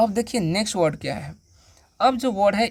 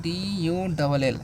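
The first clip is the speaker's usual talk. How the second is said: letters spelled slowly one by one; slow letter-by-letter spelling; much slower than usual. Shown as a demonstration. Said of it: "क्या लिखा हुआ है".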